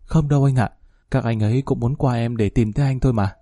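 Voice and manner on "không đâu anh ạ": a slightly trembling woman's voice; shaky voice. A narrator's reading. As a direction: let the voice tremble a little. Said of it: "Tiếng của Miên vẫn run run".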